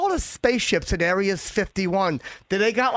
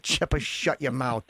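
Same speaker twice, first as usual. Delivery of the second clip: a hint of a stern mother, lower, softer and slow; lightly voiced mother, low and slow; mother snapping; 7 semitones lower, 5 dB quieter than usual. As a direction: shut the kid down like his mom. Son voices his mother.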